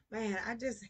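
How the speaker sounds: normal talking voice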